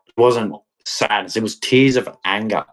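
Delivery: normal talking voice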